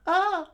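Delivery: very high, loud shriek; short falsetto yelp; girl's scream. Acted. Girl found by the bandit, screaming.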